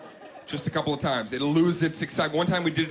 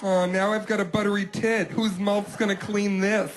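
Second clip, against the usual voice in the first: teasing fake innocence; 6 semitones higher than usual. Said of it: "toying around with me".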